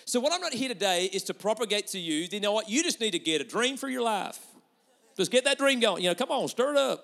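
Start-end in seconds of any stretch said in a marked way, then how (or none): 3.0-7.0 loud, higher, hammed-up American hype-man accent; a light mock American pep-rally accent, loud and high. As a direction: pump it up like an American motivational speaker, tongue in cheek. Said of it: "I did it in American".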